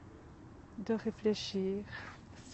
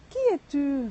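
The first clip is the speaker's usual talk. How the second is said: echo reply; 5 semitones higher, 8 dB louder than usual. Acted. Mountain echo answering the boy, louder and higher. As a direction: echo throws the question back, louder and higher. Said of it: "la réponse qu'il reçoit".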